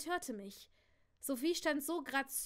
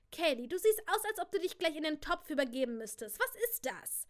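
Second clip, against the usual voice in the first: loud, raised-pitch needling; disgusted challenge; 3 semitones higher, 4 dB louder than usual. Acted. Stylish cousin eyes leftover cheese in the kitchen, disgusted. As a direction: pounce on her, jumping high on the last question. Cousin sneers at how she looks.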